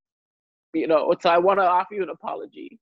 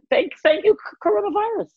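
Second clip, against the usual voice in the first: excited ironic thanks; 4 dB louder than usual. Real, not acted.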